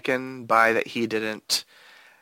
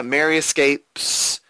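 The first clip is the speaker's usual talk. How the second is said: normal talking voice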